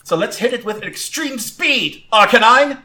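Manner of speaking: trainer's command, rising to a loud high shout; calling out. Acted. Trainer sends Arcanine in with extreme speed, calling out. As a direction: call the attack out to your Pokemon. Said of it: "Gwen calls out".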